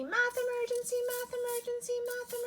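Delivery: very high mock-panic alarm chant; alarm call. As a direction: chant it like a siren. Third math emergency.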